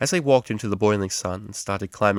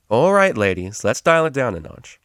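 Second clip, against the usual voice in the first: firm interruption; 4 semitones higher, 6 dB louder than usual. Acted. Tutor breaks up fight.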